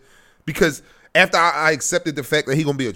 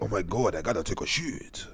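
lower, softer put-on French accent; mock French accent; 6 semitones lower, 9 dB quieter than usual. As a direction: fake a French accent, quietly. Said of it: "If you say it with that accent".

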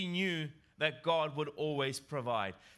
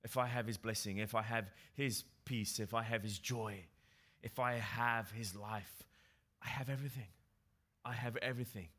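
softer, settled voice of quiet contentment; contented trust; 7 semitones lower, 5 dB quieter than usual. Retold or imitated. Preacher voicing a believer who needs nothing, softly content. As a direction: let it sink in, softer and at peace. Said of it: "that attitude of saying".

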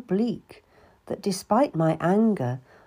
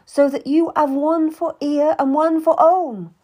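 higher, louder tearful lady's voice; pleading Anne; 8 semitones higher, 6 dB louder than usual. Acted. Anne apologizes to Cat, pleading and tearful. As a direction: beg forgiveness, voice rising.

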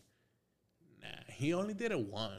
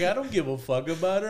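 higher, louder indignant insistence; retold insistent protest; 5 semitones higher, 8 dB louder than usual. Patient insisting to eye doctors, retold indignant protest. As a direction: replay the fed-up patient digging in.

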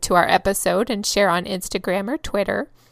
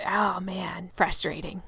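whispered frustration; 4 semitones lower, 6 dB quieter than usual. Real, not acted. Unvoiced exasperated whisper.

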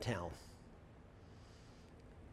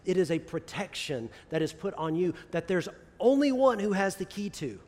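ordinary talk

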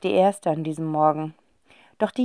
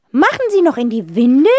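put-on higher, louder old aunt question, rising; 6 semitones higher, 6 dB louder than usual. A narrator's reading. Aunt asking about the twins, nosy. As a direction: ask it like a nosy old lady. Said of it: "fragt Tante Rotzinder".

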